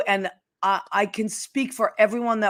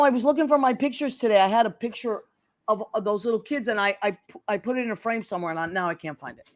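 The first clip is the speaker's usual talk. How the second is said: normal talking voice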